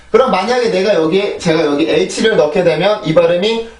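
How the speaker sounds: ordinary talk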